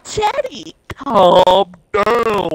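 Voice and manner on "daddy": put-on high little-kid coo; cooing baby voice. Teasing a friend with a high baby coo. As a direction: coo it like a toddler.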